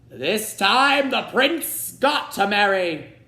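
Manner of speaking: high, loud, slow blustering king; king's voice. A narrator's reading. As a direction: bellow like an impatient old king. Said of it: "he told the Grand Duke".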